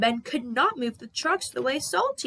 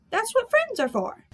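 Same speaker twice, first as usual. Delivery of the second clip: cheerful; 11 semitones higher than usual. Acted. Harold cheerfully offering help.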